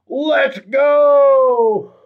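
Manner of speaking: high, drawn-out rallying cry; hyped fan cheer. Acted. Host reading out a viewer's cheer, hyped drawn-out call. Reads fan's cheer with gusto.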